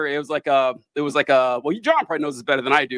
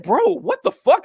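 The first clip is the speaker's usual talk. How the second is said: mock outrage; 10 semitones higher than usual. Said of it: "it's like".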